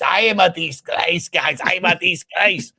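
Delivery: slow, loud mock-distraught manager; accented coach wailing in mock despair; Mikel Arteta crying impression. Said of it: "have you got Arteta crying?".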